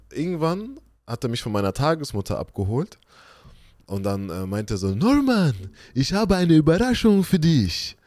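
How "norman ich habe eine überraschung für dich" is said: high, loud mock-excited announcement; excited father. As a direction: announce a big surprise to a child, hammed-up. Voices father announcing a surprise. (übertrieben begeisterter Vater)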